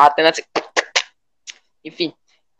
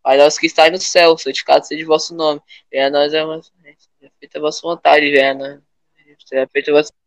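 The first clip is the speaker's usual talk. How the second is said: prayer recitation; 4 semitones lower, 4 dB quieter, faster than usual. Shown as a demonstration. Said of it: "eu só ouvi o pastor falando".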